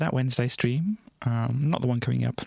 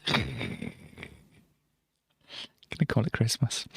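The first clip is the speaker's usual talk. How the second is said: whispering; 3 semitones lower, 4 dB quieter than usual. Real, not acted.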